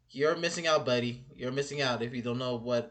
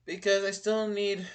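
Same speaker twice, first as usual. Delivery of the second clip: ordinary delivery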